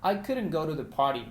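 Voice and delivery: "couldn't" with no L, run together naturally; natural English example; example sentence. Shown as a demonstration. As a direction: model the line as a native speaker would. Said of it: "decirlo en negativo".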